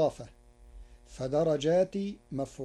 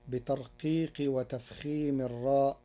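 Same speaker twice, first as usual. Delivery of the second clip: ordinary delivery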